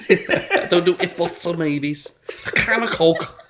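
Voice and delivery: high, squeezed mock Scouse; squeaky Scouse accent. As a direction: imitate an indignant Scouser, voice squeaked out. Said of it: "squeak my voice out".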